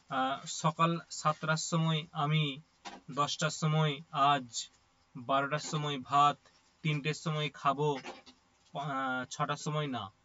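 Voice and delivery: slow, each phrase set apart by long pauses; long gaps between words. Shown as a demonstration. Teacher shows a broken-up sentence, slow with long pauses. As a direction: drag the sentence out with big breaks between the words. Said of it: "পদগুলো অনেক পরে পরে উচ্চারণ করলাম".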